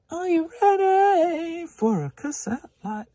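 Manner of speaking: slow, big game-show announcement; announcer catchphrase. Pretend. Reseller hyping his next lot, booming game-show call.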